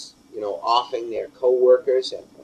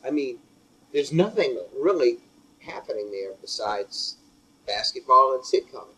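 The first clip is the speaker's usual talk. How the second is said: ordinary talk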